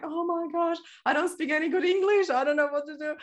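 high, panicky inner voice; nervous past self. Retold or imitated. Recalling her first workshop, mock panic. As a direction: replay your frightened self. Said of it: "I was like".

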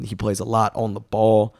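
ordinary delivery

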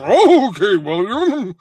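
high, loud, drawn-out horse talk; comic whinnying horse speech; realistic horse voice. Acted. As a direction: neigh the words like an actual horse. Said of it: "trying to be anatomically correct to what a horse would sound like".

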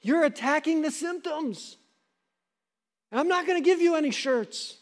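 a hint of a friend saying no; lightly voiced blunt friend. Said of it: "And you'd be like".

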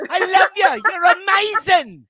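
high, loud mock Cockney shouting; yelling fan. Teasing her as a fan yelling from a table, mock yell. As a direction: holler across a crowded room, hammed up. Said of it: "standing up on a table yelling".

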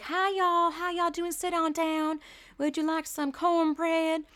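high-pitched, sugary Southern hostess; mock-sweet Southern accent, high voice. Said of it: "Very sweet and blah".

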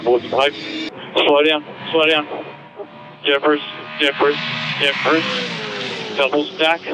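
ordinary talk

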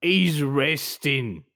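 evasive British shopkeeper, pitch swooping; wheedling British shop owner; British accent. Acted. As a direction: deny it in a put-on British accent. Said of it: "We're gonna try our British accents".

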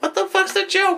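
high-pitched mock bafflement; retold squeal of confusion; baffled outburst. Kid asking older brother about a joke, high-pitched bafflement. Imitates own baffled childhood reaction.

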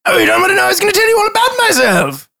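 high, loud theatrical stranger; high character voice. Acted. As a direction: announce it high and grand. GM voices dragon.

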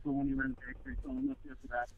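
distant bad call audio; far from mic. Real, not acted. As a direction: voice fading out over the line. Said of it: "you sound really far away".

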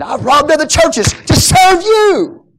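high, loud, drawn-out cry; indignant shout. Acted. Paul to the offended Corinthians, indignant shout. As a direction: shout it, stretching the final words. Acting Paul's indignant retort.

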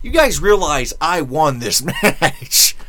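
hammed-up indignant wrestler; mock Scott Hall. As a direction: drag it out like an annoyed wrestler signing the photo. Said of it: "He goes".

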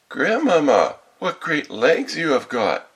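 high, loud, drawn-out wonder; greatly amazed. A narrator's reading. Girl marvelling at grandmother's odd body, greatly amazed. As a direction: exclaim like an astonished little girl. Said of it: "being greatly amazed".

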